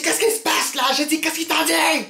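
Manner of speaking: high, demanding yell; acted yelling. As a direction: play the crazy woman, confrontational. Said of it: "je commence à crier".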